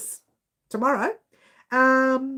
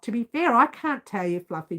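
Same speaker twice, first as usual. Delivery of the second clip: normal talking voice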